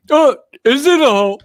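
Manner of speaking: hammed-up triumphant golf call; excited Bill Murray. Ending the Caddyshack bit, a loud mock-excited cry. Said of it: "we can do the Bill Murray impression".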